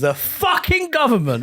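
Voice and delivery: laughing, "fucking" punched in a news voice; overemphasized news read. Shown as a demonstration. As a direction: hammer "fucking" like an overeager anchor. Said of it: "put a bit more emphasis".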